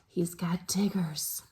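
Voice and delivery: low hushed whisper. Real, not acted. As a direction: confide it in a whisper. Whispered aside to children.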